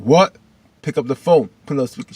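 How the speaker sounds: urgent, push her to pick up; demanding. Retold or imitated.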